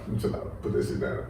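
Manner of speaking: unvoiced whisper calming himself; breathy whisper pulling it together; whispered self-talk. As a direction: whisper it to steady yourself. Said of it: "Nah, I'm just".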